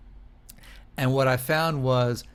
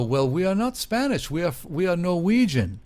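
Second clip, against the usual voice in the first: put-on higher, stiff foreign-accented correction; Norwegian tourists; 6 semitones higher, 3 dB louder than usual. Tourists correcting the van driver, lightly foreign accent. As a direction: correct him with a careful foreign lilt. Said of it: "these guys go".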